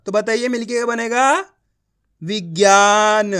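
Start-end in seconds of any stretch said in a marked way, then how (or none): 2.2-3.3 विज्ञान very slow and loud, long middle vowel; teacher's drawn-out, projected विज्ञान; blended word demo. Shown as a demonstration. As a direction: stretch out विज्ञान syllable by syllable. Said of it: "एक एक अक्षर का उच्चारण कर रहा हूँ".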